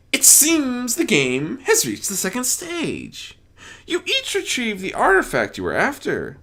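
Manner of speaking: high, theatrical, gleeful game master voice; grand Discord. Acted. Discord announces the next stage to the ponies, gleeful and theatrical.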